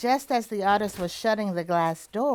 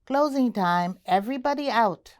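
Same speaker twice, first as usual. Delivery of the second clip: louder call to the room; 5 semitones higher, 5 dB louder than usual. A narrator's reading. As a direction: call out to everyone, a bit louder. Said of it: "the manager of the laundromat called".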